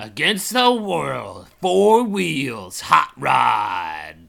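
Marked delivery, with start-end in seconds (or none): 1.6-4.2 slow booming announcer hype; monster truck ad. Pretend. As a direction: boom it out like a rally ad.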